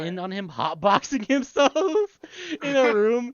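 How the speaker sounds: loud, high, laughing through the words; cracking up. Real, not acted. Telling friends about the hotboxing scene, really cracking up. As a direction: tell it while bursting into laughter.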